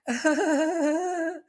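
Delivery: high awkward nervous giggle; nervous laugh. Shown as a demonstration. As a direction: laugh like you're uncomfortable. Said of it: "it goes a little something like".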